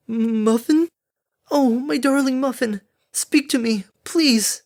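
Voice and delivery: high, pleading cry; anguished mother. Acted. Derpy begs her hurt foal to speak, anguished. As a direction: plead desperately at a high pitch.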